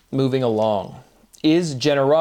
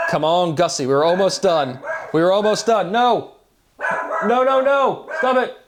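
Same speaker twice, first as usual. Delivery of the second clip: higher-pitched pleading that climbs to cries of no; real rising exasperation at a pet; exasperated scolding; 6 semitones higher, 3 dB louder than usual. Real, not acted. Speaker interrupted by Gussie when almost done, exasperated scolding. Scolding barking dog mid-recording.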